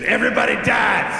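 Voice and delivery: mock grandfatherly shout. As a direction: blurt it out loudly as grandpa. Acts out grandfather announcing death.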